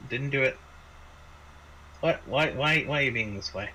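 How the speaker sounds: ordinary delivery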